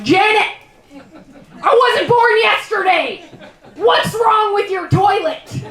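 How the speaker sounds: loud, high, fed-up demand; exasperated shout. Retold or imitated. Comedian to friend Janet, a loud exasperated accusation. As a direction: call her out on the toilet, loudly. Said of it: "I'm like".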